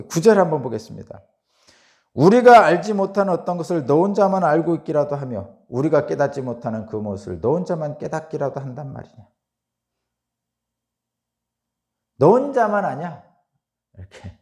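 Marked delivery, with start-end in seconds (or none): 2.1-13.2 louder, higher, mocking rebuke; rising scorn, building to a higher, louder jab; scornful challenge. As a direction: throw the taunt back at him. Reading Zophar's scornful challenge.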